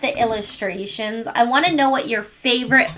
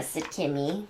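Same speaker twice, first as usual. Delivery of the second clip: plain reading voice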